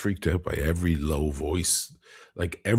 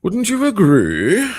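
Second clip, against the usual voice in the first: high voice; 9 dB louder than usual. Acted. High loud rhetorical question.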